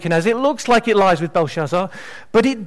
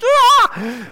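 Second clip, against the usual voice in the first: sudden high-pitched yelp of amazement; a young man's overwhelmed cry at the back of the room; weird yelp; 4 dB louder than usual. Retold or imitated. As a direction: let out a startled squeak, much higher. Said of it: "I'd let out a weird noise".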